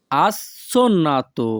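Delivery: assonnato split as "as... sonnato"; syllable by syllable. Shown as a demonstration. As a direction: break assonnato after its first syllable for learners. Teacher repeats word split for learners.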